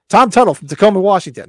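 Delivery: a loud, high quoted catchphrase; movie catchphrase. Retold or imitated. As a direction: belt out the famous line. Quoting Volunteers catchphrase.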